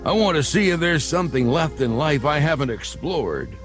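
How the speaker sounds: normal talking voice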